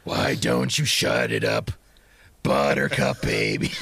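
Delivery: slow, drawn-out teasing; slow, playful threat; mock put-down. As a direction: stretch each word out slowly.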